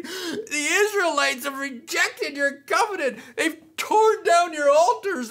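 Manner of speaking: high, whining, near-crying complaint; whiny Elijah. Acted. Pastor voicing Elijah's self-pitying complaint to God, whiny. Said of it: "here's how I imagine Elijah whiny, uh, with his whiny voice".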